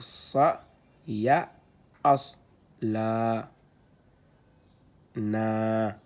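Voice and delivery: syllabes détachées, plus fortes; lecture syllabée. Shown as a demonstration. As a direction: scande chaque syllabe. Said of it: "c'est un na avec le alif". (« Wa, ma, ka, sa, ba » récité syllabe par syllabe)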